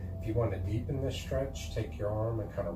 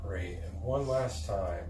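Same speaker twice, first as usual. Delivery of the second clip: ordinary talk, nothing marked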